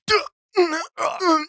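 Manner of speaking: high strained cartoon whimper; cartoon crying grunt. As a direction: squeal out an anime cry. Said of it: "the emotions are".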